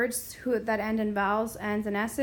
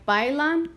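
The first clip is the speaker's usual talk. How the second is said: "bailan" stressed on BAI, pitched up; stress on first syllable; 4 semitones higher, 6 dB louder than usual. Shown as a demonstration. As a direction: hit BAI in "bailan", lighter lan. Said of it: "listen to where we hear the stress".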